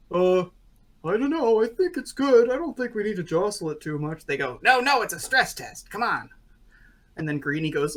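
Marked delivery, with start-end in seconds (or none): 0.1-4.2 high, loud hesitant character voice; reluctant clown. Acted. 4.6-6.3 high, loud protesting clown; insistent urging. Acted. Voices clown insisting on stress test.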